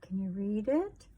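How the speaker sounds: usual speaking voice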